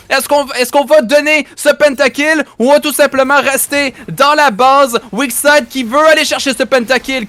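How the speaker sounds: rising, fast excited casting; excited play-by-play. Acted. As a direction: build the hype as the fight unfolds. Live casting of a teamfight.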